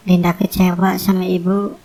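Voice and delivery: normal talking voice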